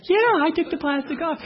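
sweet wife. Acted. Wife answers husband who couldn't work it, sweetly. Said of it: "so sweetly and so kindly".

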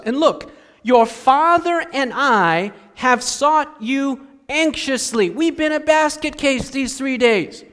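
high, building frazzled complaint; frantic frustrated mother. Acted. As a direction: pour out three days of worry, rising. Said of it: "the frustration in the tone".

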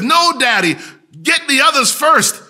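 put-on boy shouting up to his father from under the rubble; excited child's call. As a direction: call up proudly from below.

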